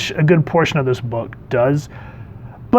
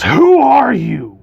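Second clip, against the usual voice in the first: slow, high, loud who are you; hammed-up angry snap; mock furious Firestar demand; 10 semitones higher, 6 dB louder, much slower than usual. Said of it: "she's pissed".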